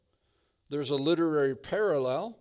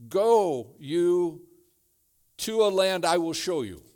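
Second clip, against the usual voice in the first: louder, slow, emphatic Go; commanding voice; 4 semitones higher, 7 dB louder than usual. Shown as a demonstration. Preacher voicing God calling Abraham, loud and slow. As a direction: proclaim it like a divine call. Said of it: "he uses the exact same command".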